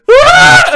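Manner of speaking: very high, loud mock yell; imitating a flying hero's scream; mock Thor scream. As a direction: let out a huge hammed-up scream. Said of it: "screaming really loud".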